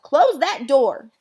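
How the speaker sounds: loud, drawn-out order; slow, loud scolding shout; yell. Acted. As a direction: yell it across the house. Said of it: "all yelled".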